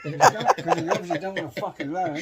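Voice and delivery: high, loud laughing agreement; laughing loudly. Real, not acted. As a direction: crack up while agreeing. Mate laughing at story.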